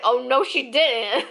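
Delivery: loud, slow sassy disbelief; bitchy. Retold or imitated. Tired traveler watching a rude stranger walk away, sassy outrage. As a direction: draw it out in mock outrage. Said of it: "being a total bitch".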